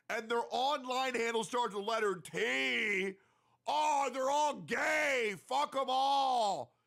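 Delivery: high, slow, mocking rant at imaginary haters; mock ranting troll. Mocking an online troll to a streamer friend, high and drawn out. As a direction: drag it out like a smug troll yelling. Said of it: "if you were to say".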